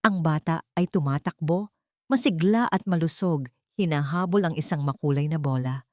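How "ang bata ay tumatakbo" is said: ordinary delivery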